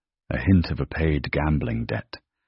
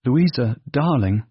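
high, loud gushing greeting; gushing cry; 9 semitones higher, 5 dB louder than usual. A narrator's reading. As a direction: cry out with false delight. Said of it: "she cried".

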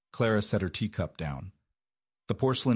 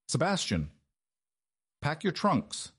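ordering; 8 semitones higher than usual. A narrator's reading. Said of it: "he ordered".

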